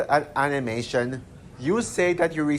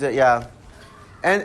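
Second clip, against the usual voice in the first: ordinary talk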